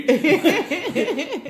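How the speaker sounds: loud high burst of laughter; big real laugh. Real, not acted. Telling the host about dad's pass, big laugh.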